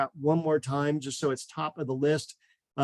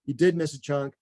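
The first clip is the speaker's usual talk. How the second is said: ordinary delivery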